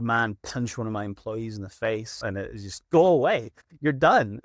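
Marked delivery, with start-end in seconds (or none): 2.9-4.4 raised, emphatic send-off; firm dismissal. Retold or imitated. Boss turning away the man who punched an employee, firm dismissal. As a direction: throw them out with force. Acted dismissal of violent client.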